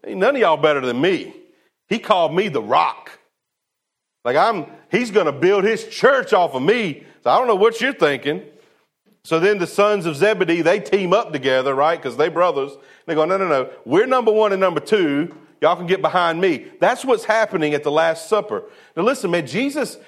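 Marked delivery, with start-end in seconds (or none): 0.0-8.4 mock indignant Peter, higher and louder; hammed-up brag of a disciple who thinks he's first; Peter boasting. Preacher voicing Peter's boast to the other disciples, mock indignant. 13.2-16.7 mock cocky boast, higher and louder; brothers bragging. Preacher voicing the Zebedee brothers claiming first place, cocky.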